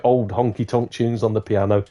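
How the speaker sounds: usual speaking voice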